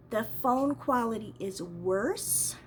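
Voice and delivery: normal talking voice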